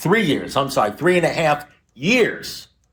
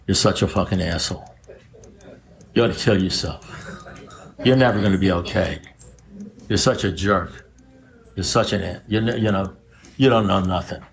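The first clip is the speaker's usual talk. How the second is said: lower, muttering self-abuse; inner critic voice; 7 semitones lower than usual. Retold or imitated. Alcoholic voicing the cruel inner voice to the room, low and contemptuous. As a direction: voice the nasty voice in your head, low. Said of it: "you hear this voice".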